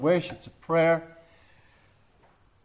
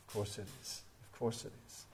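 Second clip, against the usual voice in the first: hushed, breathless whisper of assurance; 5 semitones lower, 20 dB quieter, faster than usual. Real, not acted. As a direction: lean in and whisper the certainty. Preacher repeats the assurance, hushed.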